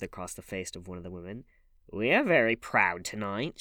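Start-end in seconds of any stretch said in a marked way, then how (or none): 1.9-3.6 louder, mocking sneer; a taunting woman's jeer. A narrator's reading. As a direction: sneer it out scornfully. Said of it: "she sneered".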